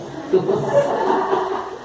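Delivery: short breathy embarrassed laugh; high laugh. Real, not acted. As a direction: laugh at your own mistake. Laughs at own slip.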